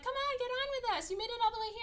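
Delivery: high-pitched, mock cajoling voice; coaxing ladies. Ladies urge the mom onto the bus, high coaxing. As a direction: imitate a chorus of ladies urging someone along.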